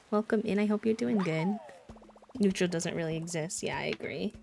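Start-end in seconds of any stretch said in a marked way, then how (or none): none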